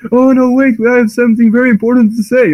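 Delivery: a flustered alien pleading for a hearing; panicked alien. Retold or imitated. The Eldar begging Ferrus to listen, hammed-up high panic. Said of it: "He's just like".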